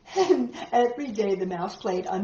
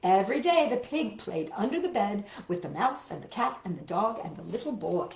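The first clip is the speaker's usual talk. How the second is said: ordinary delivery, nothing marked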